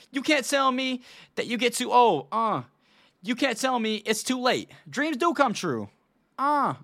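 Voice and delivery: hammed-up rapper accent, punching can't; [name]'s accent. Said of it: "should I do the accent or no?".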